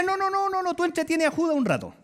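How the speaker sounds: high, rapid string of refusals; mock brush-off. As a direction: wave him away in comic refusal. Acts Jesus brushing Tomás off. (rechazo burlón y agudo)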